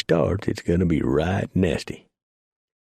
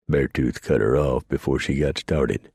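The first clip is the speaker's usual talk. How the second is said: ordinary delivery, nothing marked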